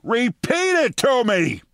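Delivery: high, slow shouted command; mock scream. Deputy mayor screaming at Giuliani, high mock yell. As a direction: yell it at him like a fed-up boss. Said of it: "screamed at Rudy Giuliani".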